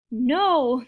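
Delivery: loud drawn-out no; yelled. A narrator's reading. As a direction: answer for the whole crowd. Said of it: "the crowd yelled".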